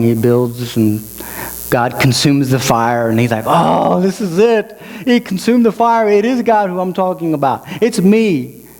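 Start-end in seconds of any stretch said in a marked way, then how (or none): 3.5-8.7 higher-pitched retold amazement; excited realization. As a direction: act out an astonished discovery, voice lifting. Acts out Gideon's amazed realization.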